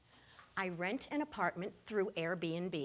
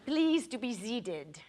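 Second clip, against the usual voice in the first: put-on slow, French-accented request; slow French official; slower than usual. French officer seating Ginger and Larry, slow French accent. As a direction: seat them formally.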